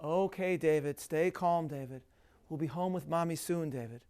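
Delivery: soft, low and measured reassurance; soothing voice. Retold or imitated. Father to son having a supermarket meltdown, soothing. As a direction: gently talk a child down, trailing softly on the name. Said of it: "saying over and over in a soothing voice".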